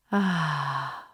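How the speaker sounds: long, relaxed exhaling sigh; audible sighing breath. Shown as a demonstration. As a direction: let the breath out with a heavy sigh. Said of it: "As you exhale with a big sigh".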